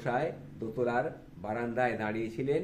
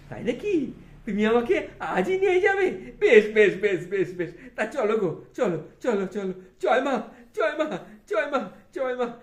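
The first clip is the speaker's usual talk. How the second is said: higher, louder, laughing joy; delighted Thakur; 12 semitones higher, 8 dB louder than usual. Acted. Thakur thrilled to meet Vidyasagar today, higher and laughing. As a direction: bubble over with childlike delight.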